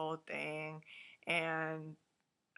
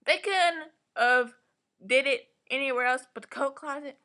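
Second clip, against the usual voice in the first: loud, heated tirade about the coats; really wound up, let it build; real outrage, getting louder; worked-up rant; 6 semitones higher, 9 dB louder than usual. Real, not acted. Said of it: "Let me calm down".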